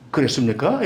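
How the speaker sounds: crisp, blunt North Korean accent. Shown as a demonstration. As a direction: snap it out firmly in a Pyongyang accent. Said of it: "이렇게 탁 나와야 되는데".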